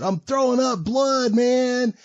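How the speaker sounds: high, loud patient's wail; hammed-up howl of distress; mock screaming. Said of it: "he's laying there screaming".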